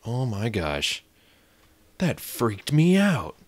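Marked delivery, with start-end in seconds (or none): 2.0-3.4 shaken. Real, not acted. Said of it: "I haven't been freaked out like that".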